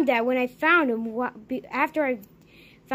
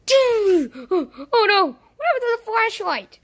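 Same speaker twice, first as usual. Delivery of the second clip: alarmed shout; 8 semitones higher, 6 dB louder, slower than usual. Acted. Sudden alarm, flashlight gone.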